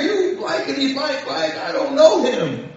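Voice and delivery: high-pitched mock cursing denial; cursing and swearing. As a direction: mimic a man swearing he's innocent. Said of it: "He begins to curse and swear".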